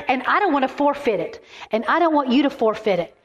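high-pitched, rousing exhortation; rally the congregation, driving each phrase home; impassioned preaching. Real, not acted. Preacher urging women to live in victory, impassioned.

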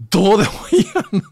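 laughing dismissal. Real, not acted. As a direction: toss it off with a laugh.

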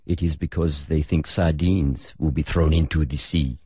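a hint of a French footballer's accent. [name] as Cantona in a French accent. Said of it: "and I'll try my best French accent here".

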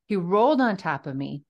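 normal talking voice

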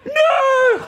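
very high, loud despairing yell; watching a rider flip off the wave, shouting; horrified shout. Retold or imitated. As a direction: scream it in disbelief. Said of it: "and we're like".

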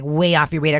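normal talking voice

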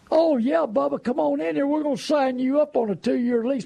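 schmooze Bubba like a car salesman; slick salesman. Retold or imitated. Car salesman hustling a customer into a lease, high and gushing.

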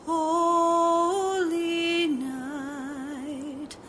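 normal talking voice

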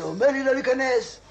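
loud, high-pitched outrage; indignant. Acted. Patient complaining about a queue-jumper, indignant.

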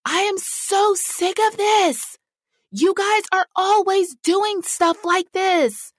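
high-pitched, slow mock rant at the kids; an exasperated mom scolding, pitched high and drawn out; imitating exasperated mom. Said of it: "But I would say things like".

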